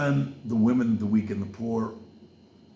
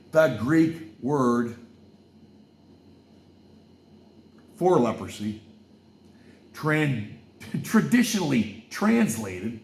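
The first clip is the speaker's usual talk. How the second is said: preaching; 2 semitones higher, 4 dB louder, slower than usual. Acted. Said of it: "repeated footnote read".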